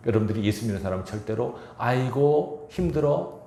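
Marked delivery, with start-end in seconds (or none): 1.8-3.4 지친 푸념 흉내. Shown as a demonstration. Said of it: "하셔야 돼요".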